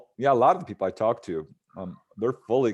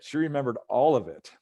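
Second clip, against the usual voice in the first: ordinary delivery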